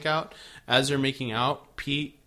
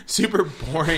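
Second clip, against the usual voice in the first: laughing; 5 semitones higher, 7 dB louder than usual. Real, not acted.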